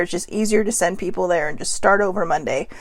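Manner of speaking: usual speaking voice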